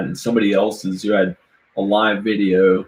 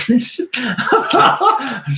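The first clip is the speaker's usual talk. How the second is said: high burst of laughter; 14 semitones higher than usual. Real, not acted. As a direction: break into helpless laughter. Laughs at own rhyme.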